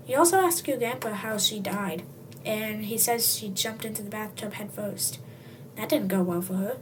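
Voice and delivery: plain reading voice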